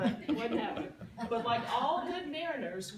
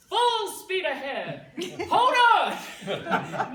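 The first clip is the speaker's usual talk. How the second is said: loud, high, drawn-out command; big hammed-up skipper's shout; captain's yell; 6 semitones higher, 12 dB louder, much slower than usual. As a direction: bellow it like an admiral at the helm. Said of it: "he guns the motor and he yells".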